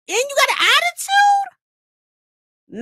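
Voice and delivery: high, incredulous outrage; outraged. Acted. Mobster hero scolding the heroine, outraged. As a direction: hit the peak of the rant. Rant climax.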